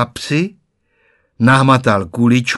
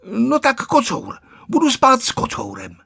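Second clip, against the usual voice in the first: put-on child pleading; 12 semitones higher than usual. A narrator's reading. Boy bargains with grandma.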